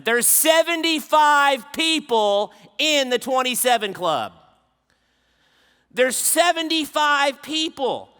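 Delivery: loud, high, emphatic sermon delivery; impassioned preaching. Real, not acted. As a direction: preach it at full force, hammering each point. Preaches at full force.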